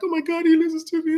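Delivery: high-pitched swooning wife impression; mock feminine squeal of delight. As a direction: gush like a thrilled wife, way up high. Acting out the wife's delighted reaction.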